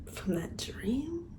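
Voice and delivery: hushed whisper. Real, not acted. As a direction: breathe it out slowly. Dreamy retelling.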